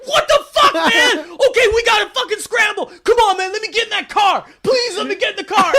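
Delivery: high, loud shouting pleas; hammed-up scramble, begging; frantic players. Retelling teammates begging for a seat, high loud shouting. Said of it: "everyone's like".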